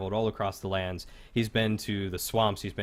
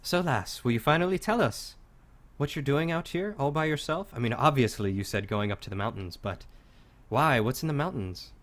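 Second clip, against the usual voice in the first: curious Kor; 5 semitones higher than usual. Acted. GM voices Kor questioning player.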